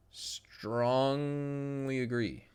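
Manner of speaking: very slow, stretched "Strongly"; drawn-out agreement. Real, not acted. Streamer answering a viewer's question, drawn-out emphatic agreement. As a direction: draw out "Strongly" in one long held sound.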